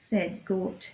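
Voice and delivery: ordinary delivery, nothing marked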